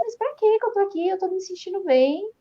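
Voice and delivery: high-pitched, puzzled protest; retold bewilderment. Patient in the ICU not understanding why, retold bewilderment. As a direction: question it as if baffled to be there. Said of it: "eu não tava entendendo nada".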